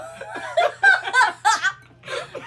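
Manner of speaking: loud rising cackle; loud high laugh. Real, not acted. Laughing mid-sentence.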